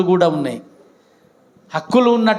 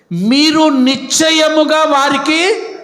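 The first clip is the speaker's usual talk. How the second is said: much higher, louder and slow; loud proclamation; 9 semitones higher, 5 dB louder, much slower than usual. Shown as a demonstration. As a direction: call it out with a laugh. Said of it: "గట్టిగా చెప్పండి అయ్యా". (గట్టిగా, నెమ్మదిగా ప్రకటన)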